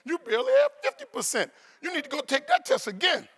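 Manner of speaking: high, loud exasperated teacher; teacher scolding. Retold or imitated. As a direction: tell him off in disbelief. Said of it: "She said".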